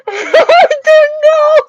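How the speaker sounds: laughing it out, slow and high; nervous giggle through words; uncomfortable laugh. Real, not acted. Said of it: "That's my uncomfortable laugh".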